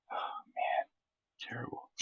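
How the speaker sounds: soft unvoiced whisper; whispered dismay. Real, not acted. Reacting alone to the bad show, whispered dismay. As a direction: sigh it out under the breath.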